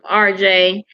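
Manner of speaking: normal talking voice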